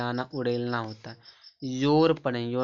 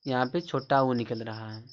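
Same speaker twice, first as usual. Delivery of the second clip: normal talking voice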